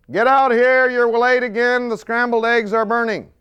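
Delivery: high, loud nagging wife shriek; wife yelling at late husband, mock shriek. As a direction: shriek from the kitchen. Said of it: "she's shrieking from the kitchen".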